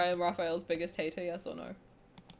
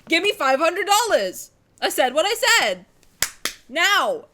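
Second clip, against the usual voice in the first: loud, higher insistent shout; loud demand; 6 semitones higher, 12 dB louder than usual. Acted. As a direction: yell it like a demand. Demanding money from character.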